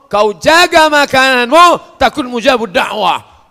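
high, loud, slow exhortation; preaching shout. Acted. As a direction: urge them hard to guard their food.

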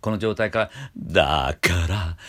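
normal talking voice

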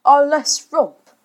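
slow, loud anguished groan; grieving father, drawn-out lament. A narrator's reading. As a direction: groan it out, high then falling. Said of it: "Mister Darling groaned".

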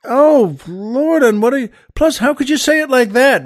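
high, upset disbelief, rising in pitch; a brother reeling from blunt news, high and upset; shocked outburst. Acted.

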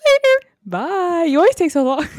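mock squeaky voice. Mock high voice during outro.

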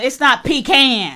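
slow, with "pecan" as PEE-can; "pecan" with long EE and drawn short A. Shown as a demonstration. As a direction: draw out "pecan" as PEE-caan, rejecting it. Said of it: "Let me tell you something".

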